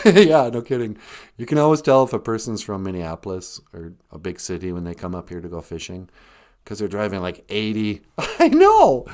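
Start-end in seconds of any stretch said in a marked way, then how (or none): 0.1-8.0 much lower, fast, different voice; a low companion voice chiming in; puppet partner voice. Acted. Puppet partner complaining about Minneapolis drivers, low voice. Second voice, low far timbre.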